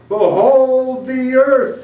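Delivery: loud, high, slowly drawn-out proclamation; booming bardic summons; grand command. Acted. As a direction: command a whole crowd to look, stretching each word. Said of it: "he commanded".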